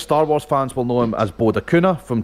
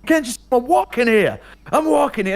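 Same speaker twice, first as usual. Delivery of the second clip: high angry New York yell at a cab; Dustin Hoffman yell; 10 semitones higher than usual. Retold or imitated.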